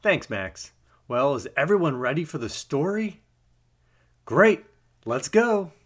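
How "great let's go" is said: usual speaking voice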